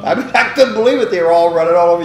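reliving the chaos with wonder; excited amazement. Real, not acted. Amazed at the auditors' invasion.

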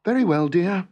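high, agreeable wifely reply; smiling to herself. A narrator's reading. Wife lets husband have his way, smiling to herself. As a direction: give in with a secret smile. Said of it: "smiling to herself".

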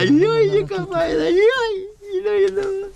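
high squealing delight. Acted. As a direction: squeal with glee over her. Ecstatic squeals over woman.

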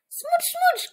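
very high smooch smooch; playful smooch. Acted. Teacher shows kisses to children, squeaky playful. Kiss sounds.

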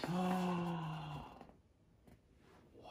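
hushed, awed gasp; storyteller's hushed awe; gasp and soft wow. Acted. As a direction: breathe out wonder. Reader marvels at discovery.

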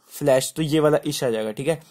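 ordinary talk

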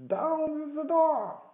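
high, drawn-out loud shout; commanding cry. A narrator's reading. Blind beggar ordering his men at the inn, loud cry. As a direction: bellow the order slowly at a high pitch. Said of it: "he cried".

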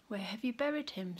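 soft, tender, loving question; full of love. Acted. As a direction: ask it gently with love. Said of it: "full of love".

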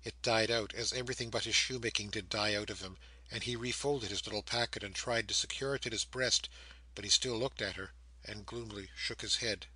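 plain reading voice

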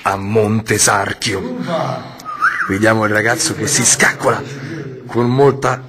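low, hushed reporter voice; mock documentary narrator.

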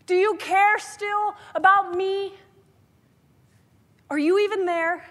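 yelling at God in desperation. Retold or imitated. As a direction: cry out to God in frustration. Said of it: "I start yelling at God".

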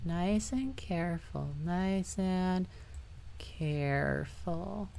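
soft, drawn-out self-coaching; careful coaxing. Real, not acted. Player steering gingerly through the game, careful coaxing. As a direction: ease it along gently, hushed.

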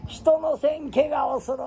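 人のせん怪我をする shouted high, stress on 人; shouted scolding. Retold or imitated. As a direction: yell the familiar scolding phrase. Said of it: "言うて".

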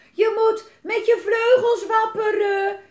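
high, slow, drawn-out yell; screaming. A narrator's reading. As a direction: scream instructions down over an edge. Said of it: "gilde ze over de rand".

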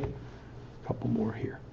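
slow, breathy whisper to the room. Real, not acted. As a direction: drop to a hushed, confiding aside. Lecturer moving to final points, whispered.

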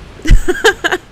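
loud, high burst of laughter; real cackling chuckle; laughing. Real, not acted. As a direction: laugh off the sudden defeat. Laughs at own death.

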